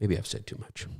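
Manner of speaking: very soft, low afterthought; quiet, low confession after a pause; hushed aside. Real, not acted. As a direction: drop right down, almost to yourself. Hushed aside after a weighty point.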